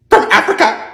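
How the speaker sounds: high, loud gushing boast. Acted. Karen gushing to her son's wife about adopting. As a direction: squeal it out like a proud trophy.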